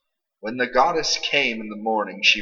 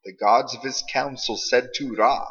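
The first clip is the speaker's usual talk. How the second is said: ordinary delivery, nothing marked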